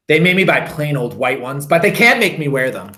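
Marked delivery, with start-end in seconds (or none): none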